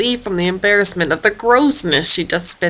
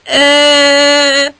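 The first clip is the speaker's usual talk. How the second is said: shrugging eh; 5 semitones higher, 4 dB louder than usual. A narrator's reading. Elizabeth can't decide.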